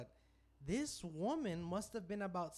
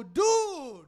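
loud shocked yell of dude, much higher; retold shout; 9 semitones higher, 16 dB louder than usual. Telling his friend the story, yells in disgust at the sight. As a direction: shout it in disbelief. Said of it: "And I go".

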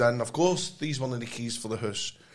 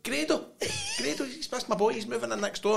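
proud mum; 10 semitones higher, 3 dB quieter than usual. Retold or imitated. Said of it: "And she was like".

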